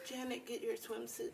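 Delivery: very soft pretend-family voice. Telling [name] to pack for the beach, hushed. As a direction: murmur it, barely audible. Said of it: "Let's all talk to each other like that".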